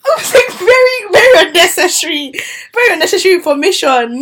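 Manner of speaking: high, fast, upset; high-pitched mock outrage. Real, not acted. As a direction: squeal it out in disbelief.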